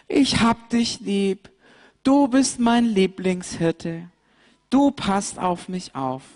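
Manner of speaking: high, tender lamb voice; a lamb snuggling up to her shepherd; grateful lamb. Acted. Mariechen thanks her rescuer, high and tender.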